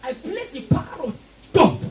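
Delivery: loud preaching over music; shouted decree. Acted. Preacher decreeing protection over children, shouting. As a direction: declare it with full force.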